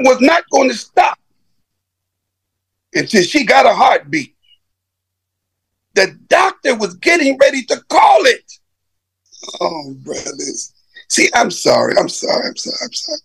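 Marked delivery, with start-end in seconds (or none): 5.9-13.2 choked up, barely getting the words out; breaking down crying. Real, not acted. Telling the congregation how he died, weeping. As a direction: voice breaking into sobs, then whispered apologies. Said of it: "I can't tell this story, man, and don't be touched".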